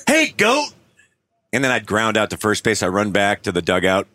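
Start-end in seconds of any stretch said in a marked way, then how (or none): 0.1-0.7 high jeering shout-out; mock heckler, pitched high; heckler's call. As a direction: call the batter out from the stands. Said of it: "he started calling me goat".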